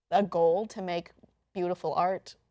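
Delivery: usual speaking voice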